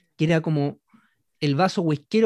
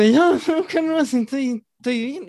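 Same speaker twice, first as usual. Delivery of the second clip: tipsy denial; 8 semitones higher, 4 dB louder, slower than usual. Retold or imitated. Drunk man insists he's fine.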